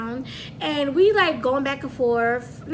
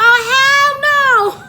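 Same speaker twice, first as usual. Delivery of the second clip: loud, high-pitched outraged yelp; alarmed protest; 11 semitones higher, 10 dB louder than usual. Retold or imitated. Passenger retelling the car suddenly driving off, alarmed yell. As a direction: cry out in shocked protest. Retold panic as car drives off.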